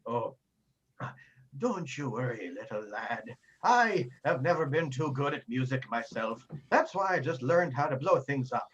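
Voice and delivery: lightly voiced old man; gentle old arcanist. Old arcanist reassuring the young player, gently. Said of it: "with a gentle smile on his face".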